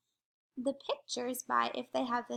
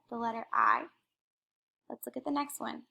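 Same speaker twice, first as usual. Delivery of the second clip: ordinary talk, nothing marked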